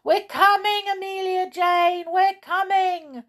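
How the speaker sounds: high, loud calling across the waves; shouting. Acted. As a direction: call out over the sea, slowing at the end. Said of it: "shouted the toys".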